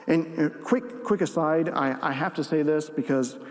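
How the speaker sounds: ordinary talk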